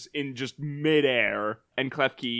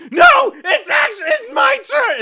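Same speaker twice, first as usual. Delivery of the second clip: screaming, much higher and louder "it's my turn!"; angry kid shout; 9 dB louder than usual. Retold or imitated. Kid on the playground shouting at a friend, furious and shrill. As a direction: shriek it like a furious child at the slide. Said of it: "they'd be like".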